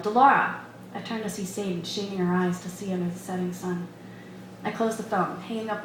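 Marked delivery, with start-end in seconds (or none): 0.0-0.5 loud, higher call across the yard; calling out a name loudly across the distance; yelled name. A narrator's reading. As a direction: shout her name to reach her far off. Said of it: "Someone yelled".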